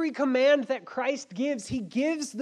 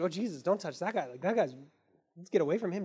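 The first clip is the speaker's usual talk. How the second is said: lower, softer anxious warning; nervous disciples; 7 semitones lower, 5 dB quieter, much faster than usual. Retold or imitated. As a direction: warn him away quickly. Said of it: "disciples were like".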